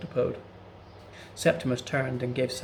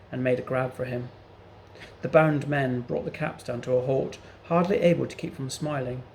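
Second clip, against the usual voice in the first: ordinary delivery, nothing marked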